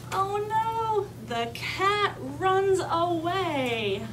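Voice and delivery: high, very drawn-out storybook alarm; dramatic read-aloud. A narrator's reading. Teacher reading the story's mishap to children, dramatic dismay. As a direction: stretch the bad news out for little listeners.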